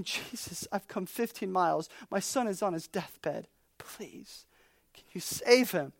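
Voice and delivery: hushed, desperate plea to Jesus, nearly whispered; begging whisper. Retold or imitated. Father begging Jesus for his dying son, hushed and desperate. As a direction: plead softly as a desperate father. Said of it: "and he is begging".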